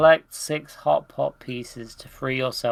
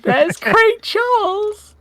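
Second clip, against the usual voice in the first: very high, slow, stretched squeal of mock delight; 7 dB louder, slower than usual. Gamer squealing at a celebrity cameo, mock delight. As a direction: gush over him like a starstruck fan.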